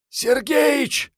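very high, urgent shout; girl yelling. A narrator's reading. Girl shouts a warning to her companion, high yell. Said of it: "девчонка сама заорала".

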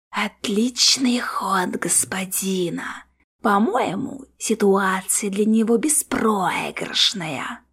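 slow, ghostly whisper from afar; faint distant voice. A narrator's reading. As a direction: whisper it slowly, drawn out like an echo. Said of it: "чуть слышный голос из коридора". (далёкий, еле слышный протяжный шёпот)

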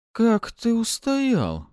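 slow, very high astonished question; stunned. A narrator's reading. Succubus amazed that he resisted her, stunned. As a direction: stretch it out high in disbelief. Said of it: "ошарашенно спросила она".

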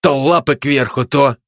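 mildly scornful reproach; understated accusing tone; reproachful. Acted. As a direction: chide someone for flip-flopping. Commando reproaching the traitor.

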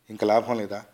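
ordinary delivery, nothing marked

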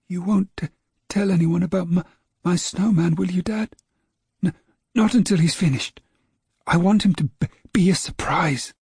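put-on high, stammering boy's voice; a little boy stumbling on his words, fed up with his dog; young boy James. A narrator's reading. Small boy grumbling at his barking dog, high and stammering. James begs Dad to keep secret.

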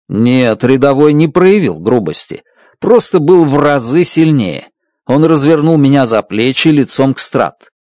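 ordinary delivery

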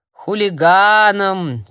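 медленно протянутое «Хулиганом», громче; тянет слово, задумавшись; протяжно. Acted. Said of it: "протянул дядя Юра". (старик задумчиво протягивает слово)

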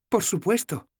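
voiced young heroine, gently teasing; Sefawin's voice. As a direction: play the tired, teasing young woman.

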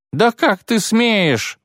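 high, drawn-out outraged shriek; a matron shrieking at the insult. Acted. Matron shrieking at Ciri over the brooch, high and drawn out. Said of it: "завопила Матрона".

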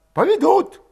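high, loud urgent character voice; agitated villager. A narrator's reading. Urging his comrade to leave the village, agitated.

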